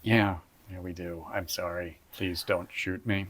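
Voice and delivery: quiet, hammy pleading; mock scared. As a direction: beg softly, playing scared.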